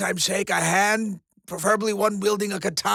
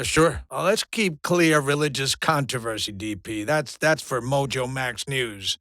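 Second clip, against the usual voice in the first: normal talking voice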